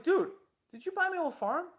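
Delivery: can't believe what he's hearing; shocked disbelief. Retold or imitated. Asking the rival if he bought his old farm, shocked and loud.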